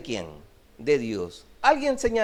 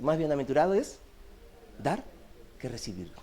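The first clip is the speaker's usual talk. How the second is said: soft repeat; 5 semitones lower, 7 dB quieter than usual. Shown as a demonstration. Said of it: "repeated verse, softly fading".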